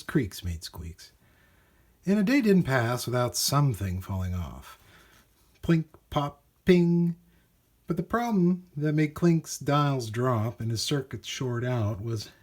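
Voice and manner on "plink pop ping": bright high plink pop ping; robot noises. A narrator's reading. Narrator voicing robot parts falling off, playful.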